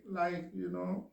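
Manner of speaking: normal talking voice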